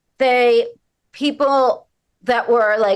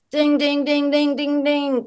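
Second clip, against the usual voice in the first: higher sung bell dings; game-show ding; 5 semitones higher than usual. Pretend. Mock quiz-show bell confirming the joke, bright dings. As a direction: ring the right-answer bell.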